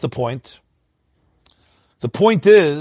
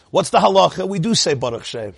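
usual speaking voice

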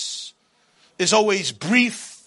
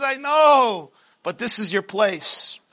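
booming motivational speech; 4 semitones higher than usual. Acted. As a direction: preach it as the taunting tyrant. Pharaoh voice held, preaching.

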